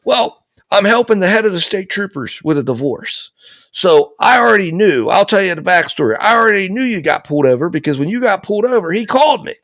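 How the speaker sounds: high, brisk retold father; imitating dad's excited talk. Father explaining the backstory to his son, excited. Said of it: "he's yelling, but not in a bad way".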